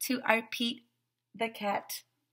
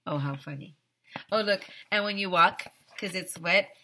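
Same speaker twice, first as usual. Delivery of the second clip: ordinary delivery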